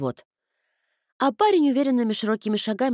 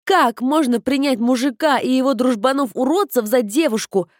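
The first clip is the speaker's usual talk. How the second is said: loud, higher scolding rant; furious tirade; 5 semitones higher, 5 dB louder than usual. Acted. Sister berating the gullible stranger, furious. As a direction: tear into him with contempt.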